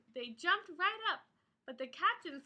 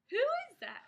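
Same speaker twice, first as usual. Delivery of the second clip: higher startled ask; alarmed question; 8 semitones higher, much faster than usual. Acted. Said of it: "asked Dots".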